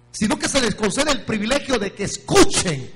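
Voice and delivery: loud, rising preacher's cry building to praise; preaching at full force. Acted. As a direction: proclaim it with rising fervour. Preaching climax. (predicación a todo pulmón, cada vez más fuerte)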